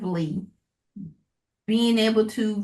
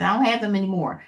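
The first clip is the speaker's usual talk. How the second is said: normal talking voice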